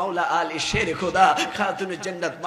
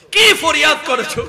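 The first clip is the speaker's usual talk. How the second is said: high, loud cry; impassioned question; 8 semitones higher, 10 dB louder than usual. Retold or imitated. Preacher as the Prophet asking Fatema, impassioned cry. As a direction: burst out with the question.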